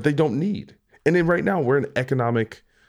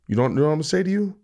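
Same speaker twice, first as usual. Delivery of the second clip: ordinary delivery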